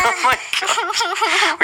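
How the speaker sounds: slow high chipmunk voice; helium voice. Real, not acted. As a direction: gasp it out on helium. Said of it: "We sound like chipmunk".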